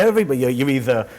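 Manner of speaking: usual speaking voice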